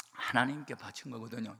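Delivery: normal talking voice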